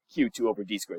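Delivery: words stretched through a yawn. Real, not acted. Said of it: "I yawned in my video".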